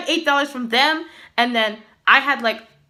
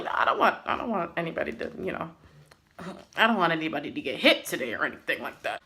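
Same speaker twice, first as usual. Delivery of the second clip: softer and lower, hurried near-whisper; hushed pleading; 7 semitones lower, 9 dB quieter, faster than usual. Retold or imitated. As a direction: rush it out softly to calm him. Calming down angry coworker Joe.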